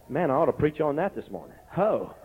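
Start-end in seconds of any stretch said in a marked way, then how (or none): none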